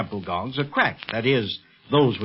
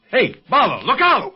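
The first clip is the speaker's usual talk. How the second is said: warning shout; 10 semitones higher, 4 dB louder than usual. Acted. Knife thrown through window.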